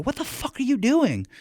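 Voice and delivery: high, shocked yell at a stranger; shocked outburst. Retold or imitated. Trainer yells at another handler mistreating a puppy, shocked outburst. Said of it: "I look over, I go".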